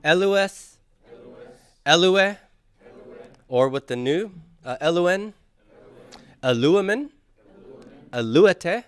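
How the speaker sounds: high, loud drill calls to the class; choral drill calls. Shown as a demonstration. Teacher leading students in practice reading, loud high calls. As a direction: lead the class in a loud recitation. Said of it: "let's do some practice reading".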